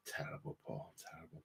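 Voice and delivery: whispered groan; breathy hushed disapproval. Real, not acted.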